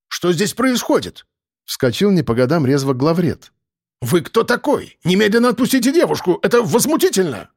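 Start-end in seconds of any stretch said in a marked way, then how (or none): 0.1-1.3 higher, slow indignant demand; startled, indignant older editor; indignant alarm. A narrator's reading. Editor protests at the intruder. 4.0-7.5 high-pitched, outraged editor; indignant and flustered; indignant protest. A narrator's reading. Editor orders intruder out.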